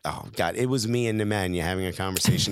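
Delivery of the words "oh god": low, soft exasperated mutter; put-out reporter. Retold or imitated. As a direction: imitate someone annoyed at an intruder. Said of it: "He was like".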